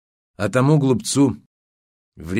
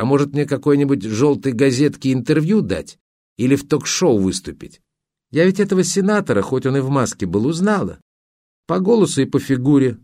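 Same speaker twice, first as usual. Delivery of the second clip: higher, lilting ingenue voice; naive woman imitated; 5 semitones higher than usual. Photographer quoting the woman who asks his advice, higher naive voice. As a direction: mimic her chirpy wheedling, pitched up.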